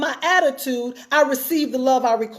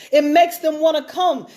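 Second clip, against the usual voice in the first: ordinary talk, nothing marked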